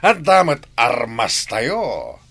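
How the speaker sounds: normal talking voice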